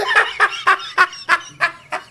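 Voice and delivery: a high laugh bursting out. Real, not acted. A man laughs at his own childhood beating story, high laughter.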